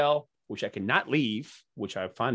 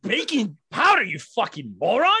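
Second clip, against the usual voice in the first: higher, louder mock rant; mock berating; 8 semitones higher, 6 dB louder than usual. Guest mock-yelling at the pancake artist on TV, higher and louder. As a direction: tear into him for fun.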